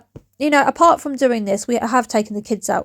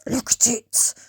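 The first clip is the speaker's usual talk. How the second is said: breathy hush; slower than usual. Pretend. Repeating, voice dropping away.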